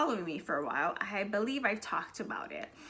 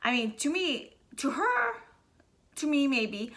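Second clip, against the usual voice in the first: ordinary talk, nothing marked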